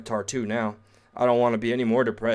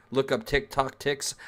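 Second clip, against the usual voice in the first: ordinary talk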